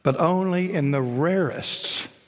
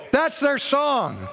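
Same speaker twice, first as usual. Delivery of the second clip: excited preaching; 9 semitones higher, slower than usual. Real, not acted. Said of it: "excited preacher".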